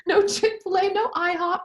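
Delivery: amused laughing repeat. Real, not acted. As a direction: laugh through the joke, high and bright. Laughing echo of the joke.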